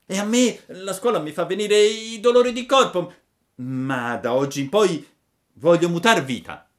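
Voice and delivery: put-on high, loud eager puppet boy; Pinocchio promising. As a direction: promise it with all your heart. (Pinocchio che promette, voce alta e forte)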